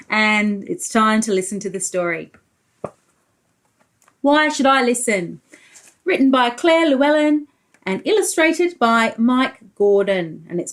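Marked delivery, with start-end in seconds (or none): none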